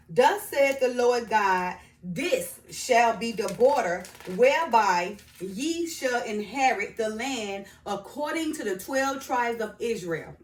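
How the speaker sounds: slow, emphatic Bible recitation; scripture reading. Acted. Reading the land's borders to viewers, scripture reading. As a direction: proclaim the verse phrase by phrase.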